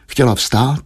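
plain reading voice

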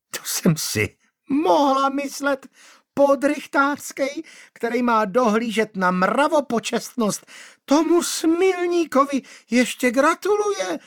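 high, shrill screech; shrieking. A narrator's reading. Manda shrieking at Václav. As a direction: shriek in outrage. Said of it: "zaječela Manda".

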